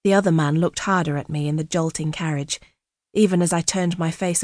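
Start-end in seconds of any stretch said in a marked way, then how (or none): none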